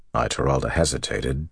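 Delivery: usual speaking voice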